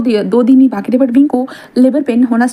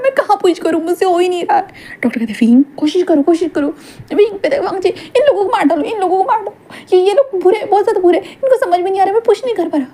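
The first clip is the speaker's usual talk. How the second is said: pleading in pain, pitched up; high desperate Wing; 7 semitones higher than usual. Acted. Wing in labour begging Wangji to kill the doctors, high desperate voice. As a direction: beg them to stop it hurting.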